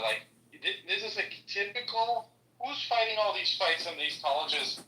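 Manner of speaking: ordinary talk, nothing marked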